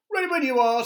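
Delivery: very high, loud boot voice; eager boot. Acted. Right boot ready to march, very high and loud. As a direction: pipe up like a keen little soldier boot. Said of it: "said right".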